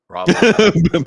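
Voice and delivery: shouted raw boo boo; loud sound drop. Retold or imitated. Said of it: "I'm just gonna go".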